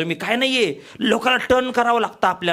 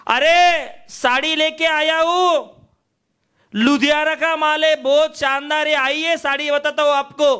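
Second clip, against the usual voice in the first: high hawker calling for the lady of the house; 6 semitones higher than usual. Retold or imitated. Hawker calling the housewife to the door. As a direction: call into the house.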